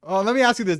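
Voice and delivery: ordinary talk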